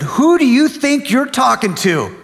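indignant, high-pitched retold outburst; acted angry dad scolding his son; going off on someone. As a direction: fire back in outrage, voice jumping up. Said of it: "started going off on him".

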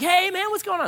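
high-pitched, casual hail; friendly greeting. Retold or imitated. Greeting an old churchgoer at the gas station, upbeat. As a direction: call out a chummy hello.

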